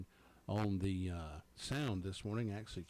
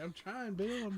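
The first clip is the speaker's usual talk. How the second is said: high-pitched mock British reply; hammed-up British accent; 10 semitones higher than usual. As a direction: put on a high, playful British voice. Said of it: "speak in my British accent".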